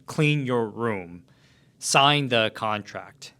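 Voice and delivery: "Clean your room, sign the contract" slow, each phrase falling; firm, slow commands that step down in pitch; downward inflection. Shown as a demonstration. Agent demoing a confident closing tone, slow downward inflection. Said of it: "In a downward inflection".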